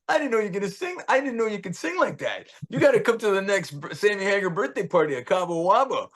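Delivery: high-pitched, impressed rocker; hyped-up retold compliment; imitated excited praise. Acts Sammy's excited invitation.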